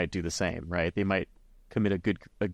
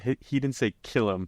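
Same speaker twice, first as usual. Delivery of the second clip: ordinary delivery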